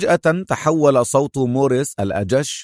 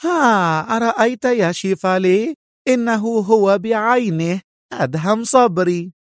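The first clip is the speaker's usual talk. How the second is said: high, triumphant exclamation; high-voiced woman exulting; joyful cry; 10 semitones higher than usual. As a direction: crow with delight, pitched way up. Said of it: "صرخت سونيا في فرح وصاحت".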